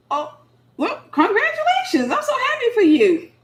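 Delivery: high, laughing mock-cheerful congratulations; silly and cool. Shown as a demonstration. Woman congratulating her engaged ex, high laughing breezy tone. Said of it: "I'm gonna show you how, how silly and cool I am".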